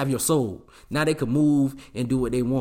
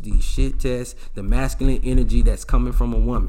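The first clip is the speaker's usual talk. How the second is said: ordinary talk, nothing marked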